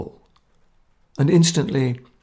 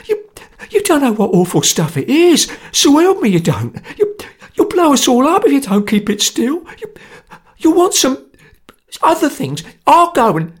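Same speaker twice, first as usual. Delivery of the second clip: high, louder, stammering terror; panicked pleading; 12 semitones higher, 6 dB louder than usual. Acted. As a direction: beg them in a panic.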